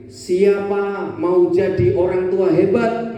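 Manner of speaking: slow, drawn-out rallying question; slow rally cry. Shown as a demonstration. Trainer demonstrating the rallying call, slow. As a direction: stretch it out to rouse the room. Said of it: "Kita beri dengan cara gini".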